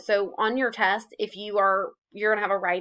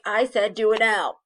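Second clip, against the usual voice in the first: louder, emphatic mock scolding; bossy mom; 6 dB louder than usual. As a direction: order them like a fed-up mom. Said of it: "like, a mom being like".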